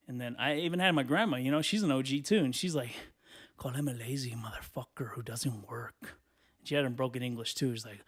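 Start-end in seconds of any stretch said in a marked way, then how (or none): 3.6-6.2 low whispered prompting; grandma's sly whisper. Acted. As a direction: whisper the insult for someone else to repeat. Grandma secretly prompting a curse.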